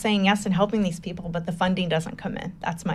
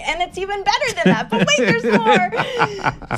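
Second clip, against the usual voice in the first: high-pitched, gleeful laughing tease; laughing excitement; 12 semitones higher, slower than usual. Real, not acted. Storyteller promising her host more, laughing excitedly. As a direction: burst with the next twist, laughing. Teasing an even better twist.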